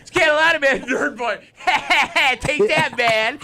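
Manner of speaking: high, loud, taunting sidekick; squeaky bully's sidekick jeering; toady sidekick voice. Retold or imitated. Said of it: "He's like that little guy that's standing next to the bully".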